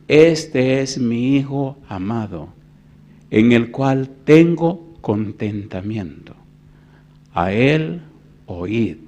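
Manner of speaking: very slow, measured recitation; solemn scripture reading. Real, not acted. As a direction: let each phrase of the verse stand alone. Reads scripture aloud. (lectura bíblica solemne y pausada)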